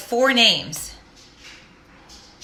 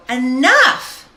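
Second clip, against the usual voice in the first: higher, louder, rising cry of enough; loud declaration; 4 semitones higher, 8 dB louder than usual. Retold or imitated. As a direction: draw a hard line out loud. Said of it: "get out of myself and go".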